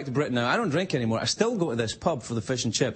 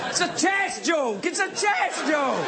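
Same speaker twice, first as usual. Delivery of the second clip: higher, slow, shouted Scottish brogue; mock Scottish shout; 12 semitones higher, slower than usual. Comic defending his chess pun, shouted Scottish. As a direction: bellow it like an indignant Scot. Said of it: "punchline in Scottish brogue".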